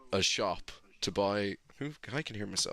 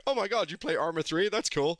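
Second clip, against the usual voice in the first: mock excited fan; 9 semitones higher than usual. Voicing excited support guy.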